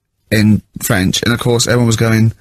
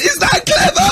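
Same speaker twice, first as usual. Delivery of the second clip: very high, scornful question; sarcastic shrieking teacher. Retold or imitated. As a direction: sneer it in a shrill falsetto. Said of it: "He went".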